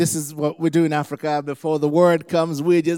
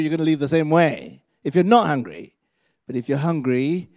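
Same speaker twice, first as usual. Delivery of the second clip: ordinary talk, nothing marked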